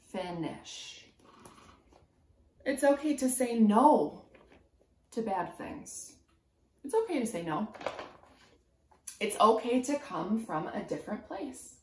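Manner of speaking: ordinary talk, nothing marked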